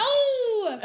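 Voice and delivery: high squeal of laughter; laughing shriek. Real, not acted. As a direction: burst out laughing. Laughing shriek after joke.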